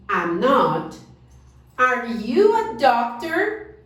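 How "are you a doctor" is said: slow model question. Shown as a demonstration. Teacher models question slowly.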